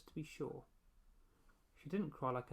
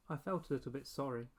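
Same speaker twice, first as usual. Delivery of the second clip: plain reading voice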